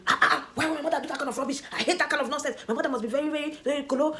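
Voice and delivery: high, fast mock disgust at her own mother; hammed-up fake outrage; mock outraged wife. Imitating what husband wanted.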